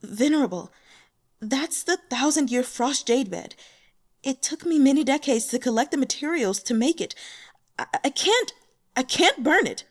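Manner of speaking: pleading, rising in pitch and volume; fearful voice. Acted. Protector begging the venerable to spare her bed, fearful. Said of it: "She said in a fearful voice".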